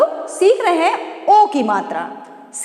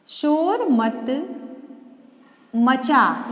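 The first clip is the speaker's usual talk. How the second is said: word-by-word, stretched and softer; slow dictation; 3 dB quieter, much slower than usual. Shown as a demonstration. Teacher dictating a sentence to young pupils, slowly. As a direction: build the sentence slowly for children. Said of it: "दूसरा वाक्य बनाते हैं।".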